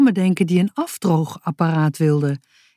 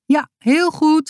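higher, slow answer called up the hill; calling back; 9 semitones higher than usual. A narrator's reading. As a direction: call the answer back up, slowly. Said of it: "riep hij". (hoger en langzaam terugroepend)

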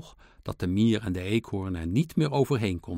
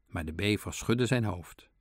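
usual speaking voice

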